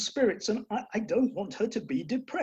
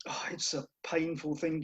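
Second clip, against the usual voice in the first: hushed whisper; 5 semitones lower, 7 dB quieter than usual. Acted. Father mourning mock-tragically.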